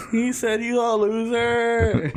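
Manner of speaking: a hammed-up gloating relay; mock emphatic translation. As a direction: drag out the insult like a gleeful translator. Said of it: "emphasizing on things".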